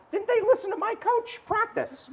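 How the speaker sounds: high, soft incredulous squeak; mock bafflement. Preacher replaying his bafflement at pros missing shots, high mock disbelief.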